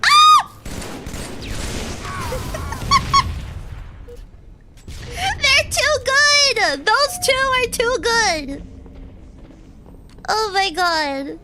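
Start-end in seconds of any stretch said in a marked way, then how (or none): 0.0-4.2 piercing scream dissolving into breathy laughter; shriek, then crack up. Real, not acted. Getting killed by surprise. 5.1-8.7 exasperated wail. Real, not acted. Losing the fight to two enemies.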